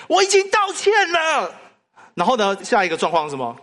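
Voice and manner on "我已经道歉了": put-on shouted high and loud like a small child; loud protest. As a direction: yell it back indignantly, child's voice. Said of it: "他就很大声地跟我说". (大声尖叫着顶嘴)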